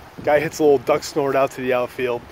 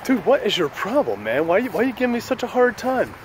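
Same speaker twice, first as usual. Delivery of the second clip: mock complaint; 8 semitones higher than usual. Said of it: "and I'm like".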